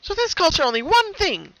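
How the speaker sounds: high, bright-toned cheerful pony voice; high cheerful cartoon pony; Pinkie Pie voice. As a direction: play the sweet pony with a sinister calm.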